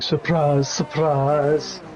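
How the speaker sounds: half-hearted impression, at ordinary pitch. Co-commentator showing his weaker version, impression without the oomph. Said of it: "doesn't quite have the oomph to it".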